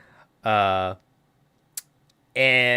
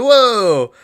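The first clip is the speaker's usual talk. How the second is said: amazed whoa; 8 semitones higher, 6 dB louder than usual. Pretend. Imagined tire speaking.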